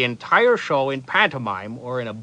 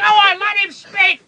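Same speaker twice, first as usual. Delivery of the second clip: heckler voice; 6 dB louder than usual. Acted. Audience heckler shouting for the reader to speak. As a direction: bellow it like a guy in the back row. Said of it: "that guy over there wants to hear me talk".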